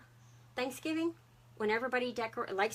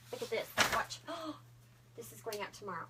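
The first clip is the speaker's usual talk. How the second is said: hushed; 6 dB quieter than usual. Real, not acted. Showing item, hushed.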